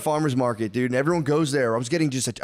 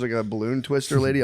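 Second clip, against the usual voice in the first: normal talking voice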